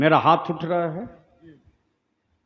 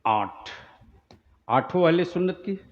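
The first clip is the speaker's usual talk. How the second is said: ordinary delivery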